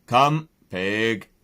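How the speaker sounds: lightly voiced farmer calling the pig; a farmer trying a dog command on a pig; playful command. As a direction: call it out half in fun. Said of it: "and just for fun".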